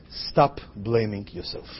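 low, soft, widely spaced words; slow earnest plea. Real, not acted. Speaker urging parents not to blame themselves, slow and low. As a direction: let each word sink in slowly and quietly.

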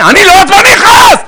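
high, loud furious yell; angry shout. Acted. Husband denying he is angry, yelling at his wife. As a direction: explode at her. Acted quarrel husband snaps.

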